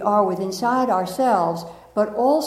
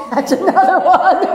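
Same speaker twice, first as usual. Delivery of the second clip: loud, higher, breaking up with laughter; laughing; 8 semitones higher, 8 dB louder than usual. Real, not acted. Speaker ticking off own foibles, laughing. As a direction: talk through real giggles.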